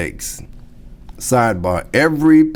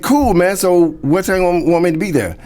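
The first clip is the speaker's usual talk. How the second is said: eager; 9 semitones higher than usual. Retold or imitated. Eager reply to Steve, retold.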